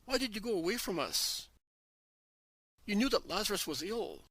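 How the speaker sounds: a sister crying out her loss at his feet; sobbing plea to her master; anguished cry. Acted. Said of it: "she shouts".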